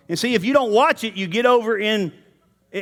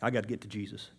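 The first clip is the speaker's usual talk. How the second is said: much softer, lower note to self; muttered aside; 10 semitones lower, 15 dB quieter, faster than usual. Real, not acted. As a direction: say it half under your breath. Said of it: "aside to himself".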